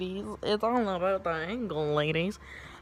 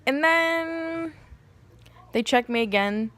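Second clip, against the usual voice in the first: ordinary talk, nothing marked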